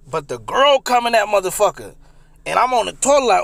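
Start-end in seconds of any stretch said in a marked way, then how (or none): none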